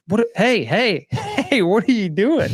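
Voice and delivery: high-pitched mock alarm, breaking into a laugh; alarmed dad. Dad stopping his kid digging in manure, mock alarm. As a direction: call out as a baffled dad, pitch jumping up.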